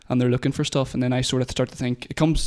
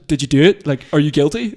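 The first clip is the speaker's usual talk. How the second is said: much louder, demanding mum's questions; mock mum; 2 semitones higher, 10 dB louder than usual. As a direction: fire the questions like an upset mum. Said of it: "so she was like".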